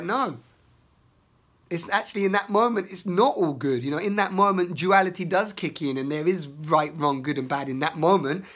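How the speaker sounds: normal talking voice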